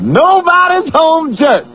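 comedian's stage-routine delivery, stretched out; Chris Rock. Acted. Radio host quoting a comedy routine, Chris Rock impression. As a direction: announce it like a stand-up catchphrase. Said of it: "That's Chris Rock".

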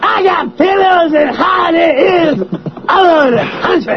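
high, hammed-up stand-up shouting; Chris Rock. Host confessing in the comic's style, Chris Rock impression. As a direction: rant in a comedian's high holler. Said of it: "That's Chris Rock".

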